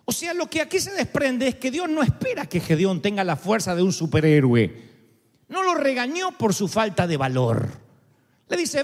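normal talking voice

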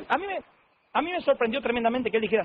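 normal talking voice

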